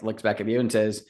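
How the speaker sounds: normal talking voice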